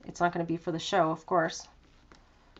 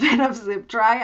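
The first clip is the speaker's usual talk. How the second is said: laughing; 2 semitones higher, 4 dB louder than usual. Real, not acted. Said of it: "I don't know why I'm laughing now".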